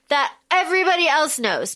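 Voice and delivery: usual speaking voice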